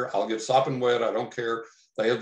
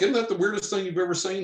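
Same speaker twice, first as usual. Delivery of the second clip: excited; 9 semitones higher, faster than usual. Retold or imitated. Excitedly showing buddy.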